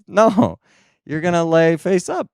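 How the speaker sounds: usual speaking voice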